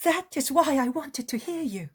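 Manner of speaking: an eager hostess welcoming a guest; high, loud woman's voice; eager village woman. Acted. Woman speaks.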